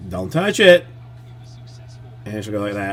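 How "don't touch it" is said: louder, higher don't touch it; the second warning, a notch firmer. Retold or imitated. Dad warns his daughter a second time, firmer. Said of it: "And I'll say".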